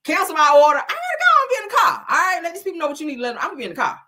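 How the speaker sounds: high, fast, urgent rush. Retold or imitated. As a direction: hurry him out the door. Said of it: "So I told my husband".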